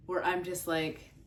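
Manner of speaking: ordinary talk, nothing marked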